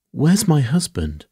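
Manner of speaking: high, loud, urgent demand; frightened cry. A narrator's reading. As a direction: cry out for him in alarm. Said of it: "she cried".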